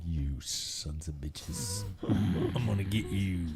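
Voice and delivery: low, slow, muttered grudge voice; mock angry Jackson. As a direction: growl it low like the guy left out. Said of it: "listening to this going".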